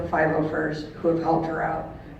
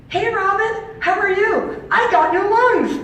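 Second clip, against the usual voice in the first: much higher, louder, bubbly; excited friend on phone; 14 semitones higher, 9 dB louder than usual. Retold or imitated. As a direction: squeal it like a friend overjoyed on the phone. Friend calls after lung transplant.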